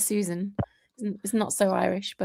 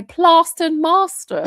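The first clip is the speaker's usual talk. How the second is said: long a in "Plaster and master", exaggerated and louder; mocking northern accent; 6 semitones higher, 7 dB louder, slower than usual. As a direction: lay on the broad a in "Plaster and master". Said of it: "weird idiosyncrasy of their accent up there".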